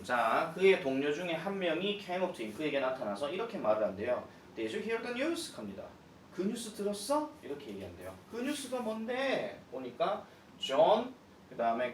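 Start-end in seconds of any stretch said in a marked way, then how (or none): 4.6-5.5 동료 흉내. Acted. 동료가 묻는 대사 연기. 6.3-7.3 동료 흉내. Acted.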